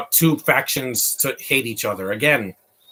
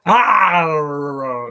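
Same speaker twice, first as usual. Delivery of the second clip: loud "ah" sliding downward; long falling groan at an awful sight; drawn-out groan; 4 semitones higher, 5 dB louder than usual. Real, not acted. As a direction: let out a long, higher-pitched cry of dismay. Groans at bad CG effect on screen.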